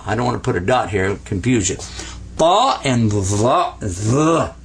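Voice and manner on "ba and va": "Ba" and "va" slow and deliberate; slow letter names. Shown as a demonstration. Teacher names letters for students. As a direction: spell out ba and va slowly. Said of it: "Let me hear that".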